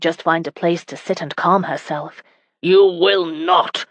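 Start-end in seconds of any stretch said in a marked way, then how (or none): 2.6-3.9 slow, heavy command; bellowing. A narrator's reading. Husband bellowing at his sobbing wife. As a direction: cut her off with a slow bark. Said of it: "Geoffrey bellowed".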